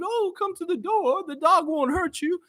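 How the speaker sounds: put-on high, sweet old-lady voice. Old lady reassuring the delivery man, high sweet voice. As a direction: coo it like a kindly old lady at the door. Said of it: "this nice old lady came".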